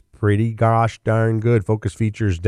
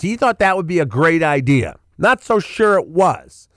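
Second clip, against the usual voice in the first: pitched up high, dry and mocking; sarcastic; 10 semitones higher than usual. Real, not acted. Commentator mocking Depp's televised-trial idea, sarcastic and high.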